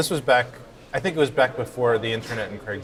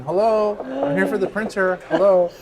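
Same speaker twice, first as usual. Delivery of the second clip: high, raised-voice hello into a house; calling out; 9 semitones higher, 3 dB louder than usual. Retold or imitated. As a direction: act out calling for whoever is home. Retold calling through opened door.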